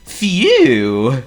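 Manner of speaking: high, loud, slow seal voice; Honk the seal. Acted. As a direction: play a polite silly seal. Honk's voice.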